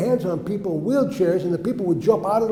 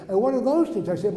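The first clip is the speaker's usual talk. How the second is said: normal talking voice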